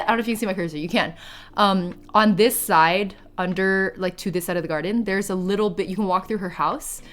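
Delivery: ordinary talk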